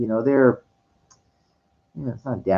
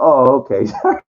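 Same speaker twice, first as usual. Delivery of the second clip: laughing operator; 5 semitones higher, 6 dB louder than usual. Retold or imitated. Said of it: "And they're".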